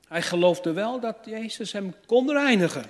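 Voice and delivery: ordinary talk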